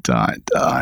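dot dot breathed as a whisper. Shown as a demonstration. Whispered end of list.

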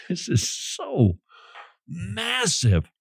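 high, soft and drawn out; hushed awe. Real, not acted. Preacher marvelling at Jesus' audacious claim, hushed awe. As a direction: let the enormity hush you.

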